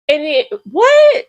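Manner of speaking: high, loud, stretched disbelieving what; outraged what. Real, not acted. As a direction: shriek it in disbelief.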